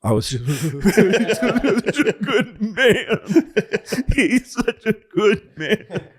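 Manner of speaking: high, slow words broken by laughter; laugh-crying. Real, not acted. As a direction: choke out through tearful laughter. Teller choked up, laughing.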